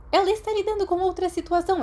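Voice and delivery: a strict witch teacher explaining; stern teacher voice. A narrator's reading. McGonagall voiced by narrator. (professora severa, voz mais aguda)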